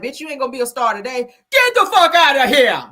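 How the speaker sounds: imitating Charles telling him off, ending loud with a laugh; mock angry. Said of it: "Charles was like".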